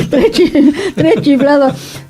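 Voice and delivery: laughing through the words; can't hold back the laugh as you repeat the joke. Real, not acted.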